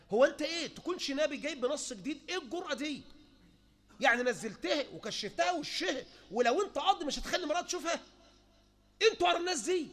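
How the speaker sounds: loud, high indignant rebuke; scolding the sheikh, high and loud; indignant outcry. Real, not acted. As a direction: rail at his nerve. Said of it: "indignant rebuke".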